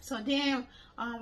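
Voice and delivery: normal talking voice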